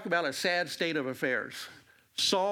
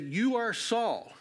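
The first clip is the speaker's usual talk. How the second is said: slow, higher-pitched mild mock cry; retold cry; 6 semitones higher, slower than usual. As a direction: cry it out slowly, without really shouting. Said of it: "she cried out and said".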